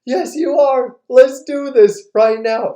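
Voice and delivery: loud, high, goading dad; insistent challenge. Retold or imitated. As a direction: push the dare hard.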